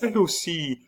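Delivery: high, repeated exclamation; astonished realisation. A narrator's reading. Nurse realising the wife did likewise, astonished. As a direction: say it high, struck by the thought.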